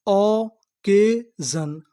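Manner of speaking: drawn-out syllables of occasion; sounding out occasion slowly; slow syllable blend. Shown as a demonstration. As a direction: stretch occasion out slowly, sounding out each part. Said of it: "तो पूरी meaning क्या बनी?".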